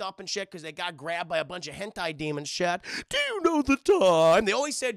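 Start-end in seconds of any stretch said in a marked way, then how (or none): 3.1-4.4 high, slow, loud eerie demon voice; slow, looming creepy question; creepy demon teacher. Retold or imitated. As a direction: drag it out like a lurking monster. Creepy demon gym teacher quote.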